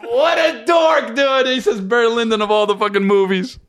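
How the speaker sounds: roast him while cracking up; howling mock jeer at his friend; laughing shout. Real, not acted. Mocking friend's movie pick.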